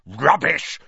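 loud, high snarling dismissal. A narrator's reading. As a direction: snarl it out with contempt. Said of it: "snarled Filch".

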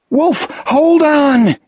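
high, drawn-out desperate yell; urgent yell. A narrator's reading. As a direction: yell to a friend to hang on. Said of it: "Jack yelled".

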